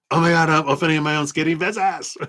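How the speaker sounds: high, fast gushing delight; excited squeal. Retold or imitated. Tarot reader voices a viewer's excitement, high and fast. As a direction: gush like the viewer's thrilled.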